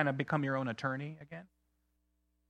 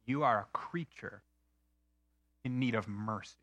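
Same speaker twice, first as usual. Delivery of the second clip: quoted verdict; 4 semitones lower, 4 dB quieter than usual. Retold or imitated. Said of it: "he is saying".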